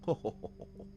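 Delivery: breathy posh ho ho chuckle; mock posh chuckle. Mocking the smug narrator with a posh chuckle. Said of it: "it's in an accent".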